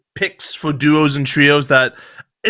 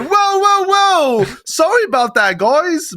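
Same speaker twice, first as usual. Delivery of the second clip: Oli crashing out; 13 semitones higher, much slower than usual. Retold or imitated. Mocking Oli's high-pitched crash-out on stream. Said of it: "Oli was like".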